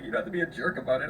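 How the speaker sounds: quick imitation of a meek objection; backed-down protest. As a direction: repeat the retreat quickly, mock-wounded. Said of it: "Brought it right back down to a four".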